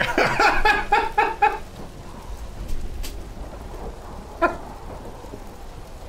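high airy laugh trailing off; can't stop laughing; wheezing giggle fit; long laughing fit. Real, not acted.

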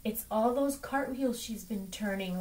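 ordinary delivery, nothing marked

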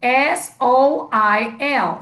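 slow letter by letter; teacher modelling the spelling; spelling aloud. Shown as a demonstration. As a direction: spell "S O I L" out slowly for the class.

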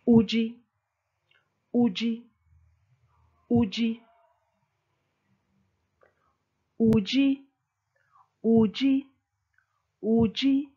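Uji repeated, each high and falling; Chiluba drill. Shown as a demonstration. Teacher drills Uji for you are. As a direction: drill Uji six times with even pauses. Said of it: "Let's start with you are".

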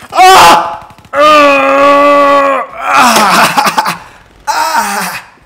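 high, long, loud screams; real pain scream. Real, not acted. Player shocking himself for the viewers, screaming in pain. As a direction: scream until it trails into gasps.

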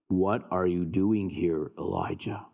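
low, measured voice of God. Acted. God asks Elijah in the cave, deep and calm.

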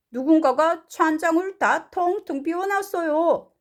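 high-pitched storybook voice; indignant story father. Acted. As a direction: act out the father's complaint. Mr. Blair's line in translation.

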